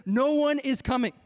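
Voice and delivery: loud, high, emphatic pronouncement; stern refusal. Retold or imitated. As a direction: deliver God's hard answer with full preaching force. God's stern answer to his plea.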